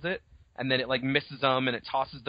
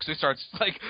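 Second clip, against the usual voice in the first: ordinary delivery, nothing marked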